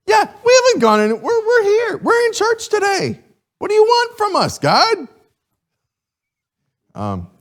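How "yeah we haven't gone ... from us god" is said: high, loud whiny protest; hammed-up whiny complaint; whiny people. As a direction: act out the people complaining indignantly.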